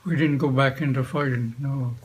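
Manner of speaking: ordinary delivery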